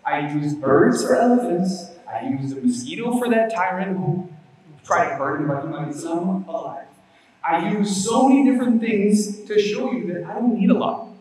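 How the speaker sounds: loud, high and forceful, like proclaiming from the pulpit; booming preaching voice. Acted. Preacher voicing Allah's words to the congregation, booming and forceful. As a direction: declare it with full conviction. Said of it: "Allah says".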